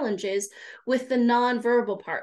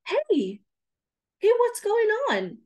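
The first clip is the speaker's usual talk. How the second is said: concerned; 10 semitones higher than usual. Retold or imitated. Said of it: "And you say".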